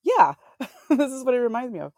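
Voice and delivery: high, loud laughing exclamation; laughing quote. Retold or imitated. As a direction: laugh through the words. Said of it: "I'm like".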